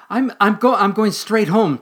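stammering, high and loud frightened squirrel; tiny scared voice. Acted. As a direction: blurt it out, scared stiff. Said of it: "said Squeakers in a tiny voice".

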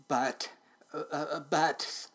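slow, halting stammer; nervous stutter. A narrator's reading. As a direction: stumble on the word, stalling before it comes out. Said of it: "stuttered the driver".

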